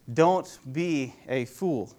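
"Don't be a fool" very slowly, word by word; slow emphatic repeat. Shown as a demonstration.

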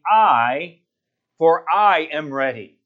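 "I" held long and loud, set apart; stress on I. Acted. Preacher shows Paul putting himself first, "I" stressed. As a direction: lean hard on "I". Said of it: "He's emphasizing what he is".

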